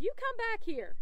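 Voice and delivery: high calling yell after the dog. A narrator's reading. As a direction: call the runaway dog back. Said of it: "I yelled".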